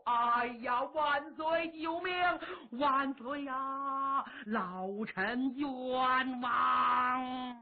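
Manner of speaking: slow, dragged-out hammed-up lament; scheming minister fake-weeping before the emperor; mock wailing plea. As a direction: drawl the cry of injustice like a fake mourner.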